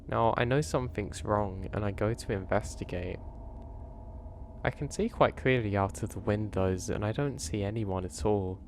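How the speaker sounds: ordinary delivery, nothing marked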